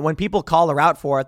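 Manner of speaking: normal talking voice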